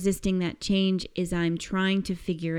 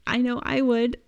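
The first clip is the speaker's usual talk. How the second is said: ordinary talk, nothing marked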